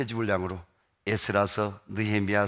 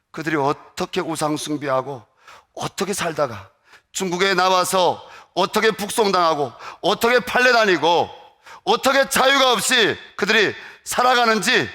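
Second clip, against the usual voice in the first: rising preaching; 7 semitones higher, 7 dB louder than usual. Acted.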